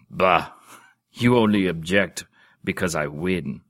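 loud, scornful Bah then a gruff retort; disgusted grunt. Acted. Old duke scoffing at a rival duke, loud and disgusted. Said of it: "grunted in disgust".

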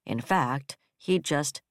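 ordinary delivery

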